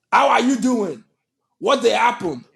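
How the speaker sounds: strong Nigerian accent. Shown as a demonstration. Showing how they don't talk, strong Nigerian accent. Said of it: "I don't have the strong accent".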